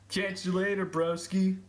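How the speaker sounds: high, put-on bro farewell; Richard voice. Parting from a friend, in a high put-on bro voice. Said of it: "said Richard".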